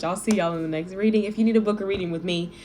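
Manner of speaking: ordinary talk, nothing marked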